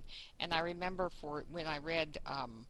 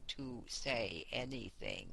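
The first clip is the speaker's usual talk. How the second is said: slow, drawn-out words, a bit lower and softer; mimicking slow reader; 6 semitones lower, 6 dB quieter, much slower than usual. Describing a narrator who took forever, said slow. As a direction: stretch each word like the sluggish narrator. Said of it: "you always had to speed her up".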